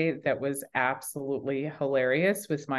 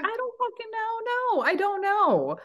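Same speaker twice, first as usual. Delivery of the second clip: exasperated; 12 semitones higher than usual. Retold or imitated. Exasperated retold reply, laughing.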